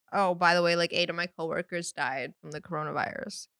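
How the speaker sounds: imitating a dad's casual, emotionless report; deadpan aside, as if it were small talk; no emotion. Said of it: "like, no emotion".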